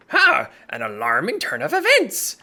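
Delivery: lightly voiced grumble; grumbling shopkeeper. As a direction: grumble it from behind. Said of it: "you hear him grumbling".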